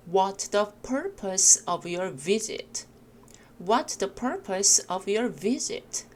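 slow model reading with weight on "What's", "purpose", "visit"; stressed key words. Shown as a demonstration. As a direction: lean on "What's", "purpose" and "visit", glide over the rest. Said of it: "세게 읽으실 부분".

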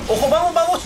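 excited urging. Real, not acted. Urging on during a fight.

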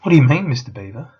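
loud, fast, out of breath question; boy panting as he climbs the bank. A narrator's reading. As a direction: gasp it out while scrambling uphill. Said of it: "panted Peter".

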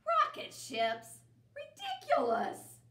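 loud, scoffing, rising to a high cry. Acted. Bear rejects the rocket book, loud and scornful. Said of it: "Bear's voice was getting louder".

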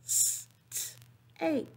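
segment the word sound by sound; phonemes broken apart. Shown as a demonstration. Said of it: "I'm gonna break the phonemes".

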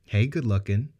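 playful flirt. Acted. Sarah flirts with Ben.

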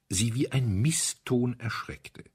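ordinary delivery

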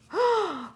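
high, sharp gasp; sudden delighted intake of breath; excited gasp. Real, not acted. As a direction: gasp in thrilled surprise. Thrilled reaction to gift.

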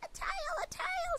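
high, delighted cry; both possums thrilled at the tail; excited shout. Acted. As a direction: squeal with joy. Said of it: "shouted both possums".

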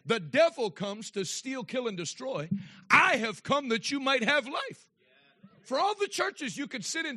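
loud, driving pulpit preaching; preaching at full force. Acted. Pastor preaching loud to the congregation. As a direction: preach it hard to the whole room.

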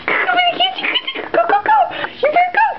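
very high, squeaky playful voice; playful chase squeal. Real, not acted. Parent chasing baby in play.

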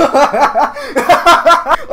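high, loud laughing fit; real helpless laughter. Real, not acted. Gamer cracking up at clan kids' names, loud laughter. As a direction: burst out laughing.

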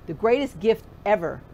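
ordinary talk

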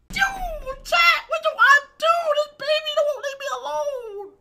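high, loud, scared yelling with a laugh in it; panicked high yelling. Real, not acted. Streamer begs chat for help as the baby chases him, panicked yelling.

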